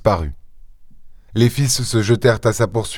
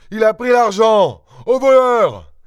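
high, urgent shout; shouting the alarm; 11 semitones higher than usual. A narrator's reading. Father alerting the house to a thief, shouting. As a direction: raise the alarm at high pitch.

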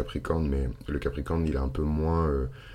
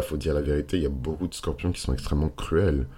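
ordinary delivery

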